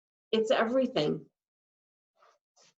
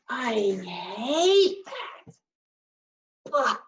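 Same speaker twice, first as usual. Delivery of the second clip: 1 disgusted hate; 2 semitones higher, much slower than usual. Shown as a demonstration.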